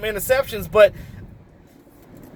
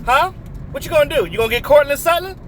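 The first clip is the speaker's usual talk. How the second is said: mocking taunt; 4 semitones higher than usual. Real, not acted. Taunting the Cowboys' receiver options.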